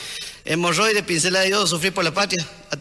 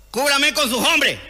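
slow, higher command; captain to sergeant, slow higher order; captain's order; 6 semitones higher, slower than usual. Acted. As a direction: drag out the order like a pompous captain.

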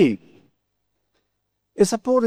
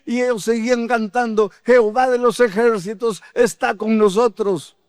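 high-pitched, fervent proclamation; prédica fervorosa; 6 semitones higher than usual. Real, not acted. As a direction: proclaim it defiantly to the congregation, pitch held high. Proclama el salmo con fervor. (proclamación aguda y ferviente)

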